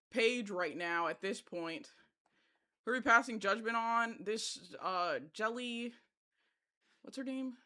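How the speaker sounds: ordinary talk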